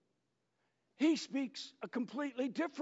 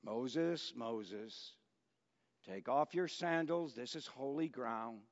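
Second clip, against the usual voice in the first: soft, much lower, hushed name calls, each rising; intimate calling; 9 semitones lower, 7 dB quieter, slower than usual. Retold or imitated. God calling Moses on the mountain, hushed and tender. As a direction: speak the name twice, close and tender. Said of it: "it's very intimate".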